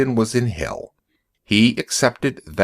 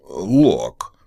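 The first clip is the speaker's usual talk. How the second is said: rough, hoarse "Look"; a hoarse, shaken voice; 3 semitones lower than usual. A narrator's reading. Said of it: "he said in a hoarse voice".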